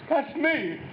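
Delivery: sudden high squeal of delight; mock proud boast. Proudly claiming to be world famous, high excited squeal. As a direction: jump high on it, delighted with yourself.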